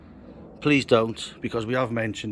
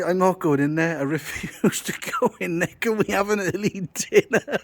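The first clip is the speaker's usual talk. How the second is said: quoting a sulky workmate; 9 semitones higher than usual. Retold or imitated. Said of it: "he's turned round and said to me".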